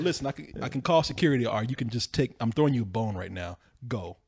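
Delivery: a hushed, serious last warning; low stern bear warning. Retold or imitated. Bear warning drunk guest away from the kids, low and hushed. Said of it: "he said".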